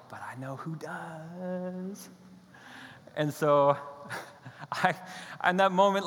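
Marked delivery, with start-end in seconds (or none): 0.1-2.1 very slow, soft, otherworldly voice; God quietly nudging the preacher. Retold or imitated. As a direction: let God's words hang slowly and softly. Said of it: "God's like".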